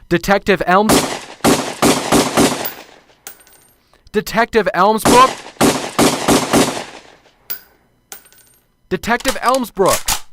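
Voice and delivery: loud, high call over his headphones. Acted. Professor calls out to the detective over headphones, loudly. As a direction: shout his name so he hears. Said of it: "Uh, right, right".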